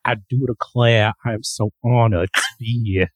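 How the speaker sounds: New Orleans-style Southern accent put on for show. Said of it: "your incredible Southern accent".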